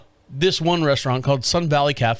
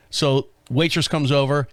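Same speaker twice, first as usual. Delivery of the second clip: ordinary talk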